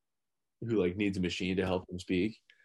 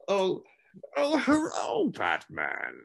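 Tom Hardy; 9 semitones higher, much slower than usual. Acted. Villain greeting Batman, swooping comic impression. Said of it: "fucking Tom Hardy and one of the Muppets".

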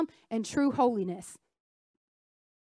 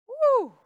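preacher's whoop; 10 semitones higher, 5 dB louder than usual. Real, not acted. Preacher's exclamation after a point.

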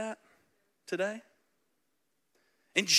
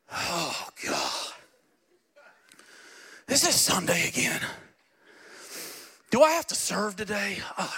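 lower, weary grumbling; reluctant groan; 3 semitones lower than usual. Retold or imitated. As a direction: drag yourself out of bed, mock reluctant. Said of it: "Now it's like".

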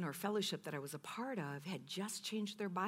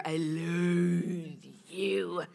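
"I loathe you" said very slowly, each word savoured; drawn out loathe; 6 dB louder, much slower than usual. Shown as a demonstration. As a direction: stretch "loathe" out long and relish it. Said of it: "rolled off my tongue better".

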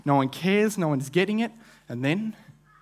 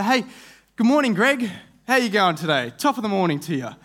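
cheery greeting; 5 semitones higher, 3 dB louder than usual. Acted. Cheerful greeting to neighbour Greg.

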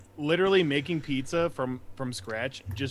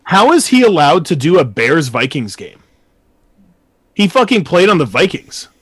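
angry rant; 13 dB louder than usual. Real, not acted. Ranting about Bears commentators.

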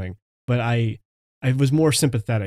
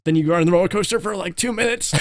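fast, climbing steeply higher in pitch; roller coaster; 6 semitones higher, faster than usual. Retold or imitated. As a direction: rush it like a coaster drop. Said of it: "That was my roller coaster impression".

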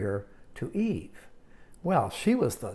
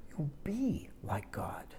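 much softer, slow, unvoiced whisper; serpent whisper; 10 dB quieter, slower than usual. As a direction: seduce her in a breath. Serpent tempting Eve.

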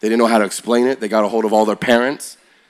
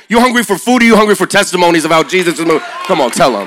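shouted, fast, much higher call to the crowd; preaching exhortation; 6 semitones higher, 6 dB louder than usual. Real, not acted. Preacher firing up the crowd, loud and fast. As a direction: fire them up at full voice. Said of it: "preacher exhorting crowd".

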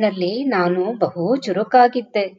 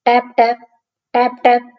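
tapping stick; 4 semitones higher, 5 dB louder, much faster than usual. Retold or imitated. Walking with a stick.

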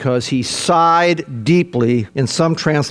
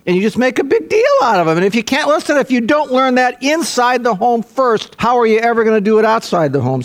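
rising fervor; 7 semitones higher than usual. Real, not acted. Preacher building to a climax.

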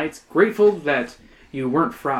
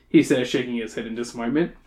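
usual speaking voice

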